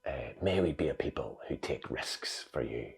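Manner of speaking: slow, soft prayed whisper; whispered prayer. Retold or imitated. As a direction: pray it slowly under your breath. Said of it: "her closing prayer".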